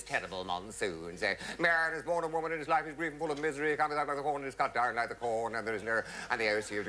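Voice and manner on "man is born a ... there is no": mock vicar intoning on a level note; funeral recitation. Vicar at the graveside in the monsoon, intoning. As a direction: intone burial rites, starting high and sinking. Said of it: "this man was standing there with all this cassock flapping".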